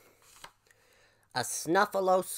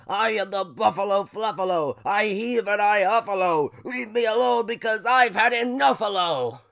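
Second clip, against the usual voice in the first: loud, higher grumpy buffalo voice; snarling buffalo; 6 semitones higher, 9 dB louder than usual. Acted. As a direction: bellow it as a huffy buffalo. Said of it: "snarled and he snuffaloed".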